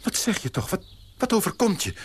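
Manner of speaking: high, alarmed disbelief; shocked. Acted. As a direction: recoil in outrage at her words. Shocked fiancé reacts. (geschokt, hoog)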